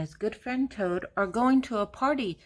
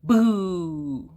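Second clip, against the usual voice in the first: beep, then boo playfully like a ghost; truck horn and boo; 4 dB louder, much slower than usual. A narrator's reading. Truck revealed under the sheet, playful boo. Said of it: "Little Blue Truck says".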